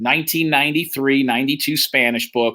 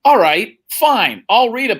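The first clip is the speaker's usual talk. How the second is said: louder, higher mock-agreeable reply; sarcastic okay-fine, raised and loud; sarcastic compliance; 6 semitones higher, 5 dB louder, slower than usual. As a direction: play along with fake cheer. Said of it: "I'm like".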